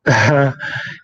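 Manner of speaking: a quick real laugh; short laugh. Real, not acted. Amused by a viewer's comment, short real laugh. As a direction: laugh it off lightly.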